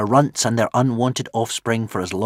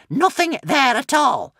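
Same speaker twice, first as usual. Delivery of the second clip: tense witch; 7 dB louder than usual. A narrator's reading. Witch pressing.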